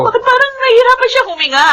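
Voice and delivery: high, fast frightened question; sudden fear for the baby; panicked alarm. Acted. Mother seeing her baby struggle to breathe, panicked.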